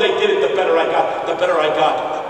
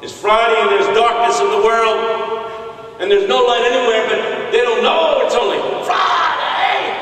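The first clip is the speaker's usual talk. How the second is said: building preacher's cadence; 7 semitones higher, 4 dB louder than usual. Retold or imitated. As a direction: swell toward a long shouted last word. Imitated pastor builds the sermon.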